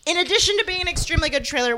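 ordinary delivery

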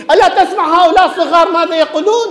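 indignant complaint. Acted. Pharisees complaining to Jesus about the crowd, mock indignant and high. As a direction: squeak it up like an outraged scribe. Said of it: "اجوا الكتب والفريسيين".

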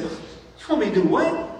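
can't believe what he's hearing; indignant disbelief. Retold or imitated. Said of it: "he said".

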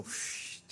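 soft hushing whoosh; quiet, breath-only psh; wave sound. Retold or imitated. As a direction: imitate waves suddenly going still. Imitates waves dying down.

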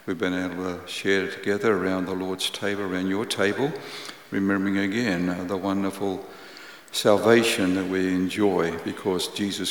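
quiet, low prayer voice. Acted. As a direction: pray softly to the Lord. Opening prayer.

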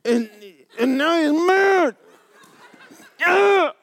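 high, loud outraged cry; hammed-up fury; mock outrage. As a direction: squeal with mock anger. Mimics angry redneck.

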